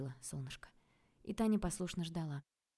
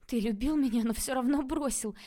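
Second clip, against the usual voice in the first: higher and louder, wounded accusation; raised voice breaking with hurt; hurt outcry; 7 semitones higher, 8 dB louder than usual. A narrator's reading. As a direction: fling it at him, stung. Tanya confronts Kostya.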